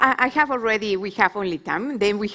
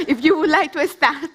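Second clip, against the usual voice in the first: laughing while speaking; 7 semitones higher than usual. Real, not acted. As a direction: say it through a real laugh. Chair laughs handing over panel.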